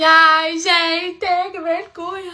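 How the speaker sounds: a loud, high cry of shame; embarrassed outcry. Retold or imitated. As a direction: wail about the embarrassment. Embarrassed outburst.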